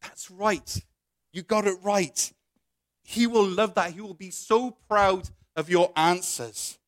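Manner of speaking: lightly voiced, falsely encouraging tempter; a touch of oily encouragement; encouraging class bully. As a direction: egg them on with mock praise. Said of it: "he's encouraging you".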